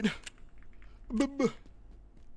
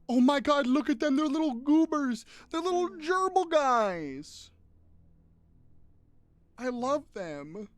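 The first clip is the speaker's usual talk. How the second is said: cooing over cute pets; 11 semitones higher, 8 dB louder, slower than usual. Real, not acted. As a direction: coo at them like pets. Gushing over cat pictures.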